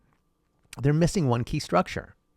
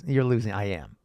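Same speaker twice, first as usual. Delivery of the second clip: ordinary delivery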